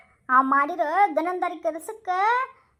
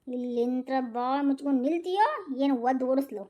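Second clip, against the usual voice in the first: low threat; 5 semitones lower, 4 dB quieter than usual. Acted. Wife threatens her husband.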